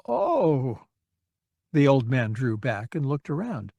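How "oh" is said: crestfallen. A narrator's reading. Old man realizes mistake.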